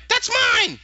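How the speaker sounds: high-pitched mock outburst; indignant claim. Lyra spotting her stolen item, indignantly. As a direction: blurt it way up high, imitating her.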